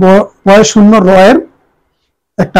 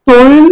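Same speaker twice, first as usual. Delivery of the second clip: তৈল lifted high and loud, "toi-lo"; emphatic demo; 5 semitones higher, 4 dB louder, much slower than usual. Shown as a demonstration. Teacher stressing the word for the class. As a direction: punch তৈল with a clear oi glide. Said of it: "সঠিক উচ্চারণ হবে তৈল".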